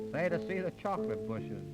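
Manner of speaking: ordinary delivery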